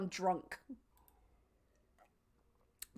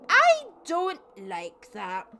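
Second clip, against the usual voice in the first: drawn-out, loud, high-pitched complaint; loud slow repeat; 8 semitones higher, 12 dB louder, much slower than usual. Real, not acted. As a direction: stretch every word in loud disgust. Repeats the protest slowly.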